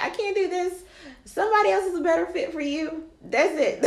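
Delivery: high, strained voice message; emotional. Retold or imitated. Retelling her voice message to him, high and emotional. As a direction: quit him with a cracking high voice. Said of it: "I'm emotional now".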